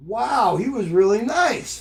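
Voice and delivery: lightly voiced wide-eyed kid; grandson voice. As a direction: play the impressed little grandson. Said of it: "And Elijah's like".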